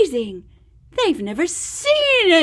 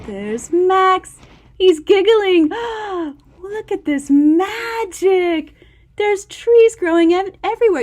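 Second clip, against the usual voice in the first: higher and louder, full of delight; excited wonder; 6 semitones higher, 6 dB louder than usual. A narrator's reading. Showing the child the magic forest, excited. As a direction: gush over the magic.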